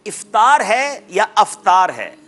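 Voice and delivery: slow "افطار ہے یا افطار ہے", first if-taar, then af-taar; drawn-out "افطار", opening i against opening a; contrasting two pronunciations. Shown as a demonstration. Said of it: "کیا تلفظ درست کرنا ہے".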